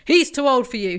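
understated fatherly ranting; mild rant. Acted. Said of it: "he ranted".

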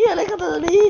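high, loud cry to get away; panicked shout. Real, not acted. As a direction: yell at the creature. Brain monster approaching.

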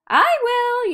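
high, loud eager yelp; excited yelp. A narrator's reading. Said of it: "yelped the dog".

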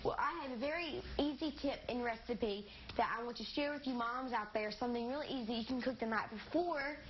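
ordinary delivery

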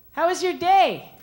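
put-on high, eager girl voice; girly voice. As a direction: play the chatty girlfriend. Girl texting the guy.

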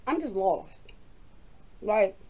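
talking while eating snack; words muffled by food; mouth full, chewing. Real, not acted. Seen 0: Holds chip, puts it in mouth at 1.1, then chews.

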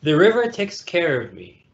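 ordinary delivery, nothing marked

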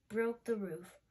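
ordinary delivery, nothing marked

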